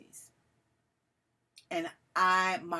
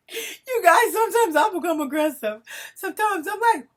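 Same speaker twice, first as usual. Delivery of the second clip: half laughing, half crying; 13 semitones higher, 12 dB louder than usual. Real, not acted. Gets upset recalling arguments.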